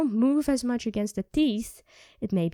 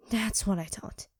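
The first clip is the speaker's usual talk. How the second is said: soft knowing whisper; quiet smug whisper; 2 semitones lower, 5 dB quieter than usual. Acted. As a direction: hush it, smug and sure. Vampire reads the human's silence.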